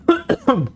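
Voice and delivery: sudden high choking cough. Real, not acted. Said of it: "I was choking on some Kool-Aid".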